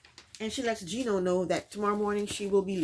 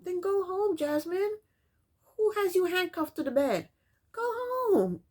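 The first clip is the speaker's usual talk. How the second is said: exasperated retort; 11 semitones higher than usual. Real, not acted. Yelling back at Jasmine on TV.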